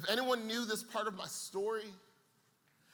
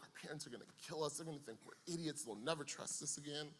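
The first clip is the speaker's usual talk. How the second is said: much softer, fast, panicked inner thought; fearful inner thought; 3 semitones lower, 11 dB quieter than usual. Retold or imitated. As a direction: rush it under your breath, dreading the call home.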